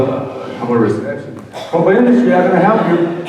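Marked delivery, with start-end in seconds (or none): 1.7-3.2 high, loud bright phone greeting; receptionist. Retold or imitated. Receptionist picking up the office phone, high loud greeting.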